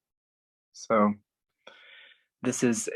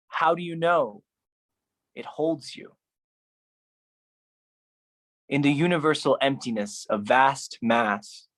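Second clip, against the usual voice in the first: dramatic poem reading; 5 semitones higher than usual. Acted. Reading teenage angst poetry to an audience, solemn and overwrought. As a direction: read your teenage poetry like it's profound. Reads own teen poetry aloud.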